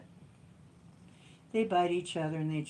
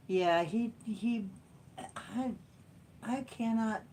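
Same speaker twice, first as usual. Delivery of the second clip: usual speaking voice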